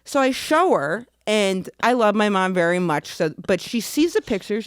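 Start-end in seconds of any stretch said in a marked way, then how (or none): none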